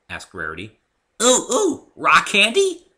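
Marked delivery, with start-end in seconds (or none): 1.2-2.9 very high, loud bouncy squeal; excited. Acted. Pinkie guessing the answer, squealing excitedly. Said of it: "Pinkie asked excitedly".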